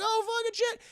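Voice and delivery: mock alarm. Everyone startled by the scream.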